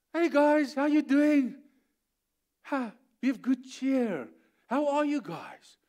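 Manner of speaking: high, breezy greeting with drawn-out words; cheery Jesus. Retold or imitated. Jesus hailing his disciples in the storm, cheery. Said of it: "And he says".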